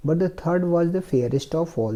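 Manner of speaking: slow, careful reading of the English passage; slow reading aloud. Shown as a demonstration. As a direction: read each word slowly for the class. Teacher reads story slowly. (धीमी आवाज़ में अंग्रेज़ी पढ़ना)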